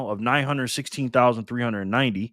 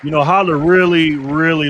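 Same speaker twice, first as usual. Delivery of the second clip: loud hype; 9 dB louder than usual. Real, not acted. Hyping a viewer on stream.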